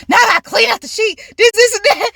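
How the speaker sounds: high-pitched flustered complaint; dramatized exasperated fluster; retold freak-out. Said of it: "I'm just freaking out".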